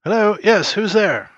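calling loudly through a closed door; shouting through a door. Acted. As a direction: yell it through the door from the kitchen. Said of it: "Are you just shouting through the door?".

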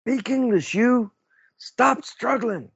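high, loud barked orders; shouting command. Acted. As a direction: yell at a struggling prisoner. Player yells at captured German.

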